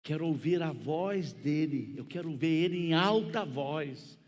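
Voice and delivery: put-on insistent young man, building to a loud high finish. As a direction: press the demand harder with each phrase.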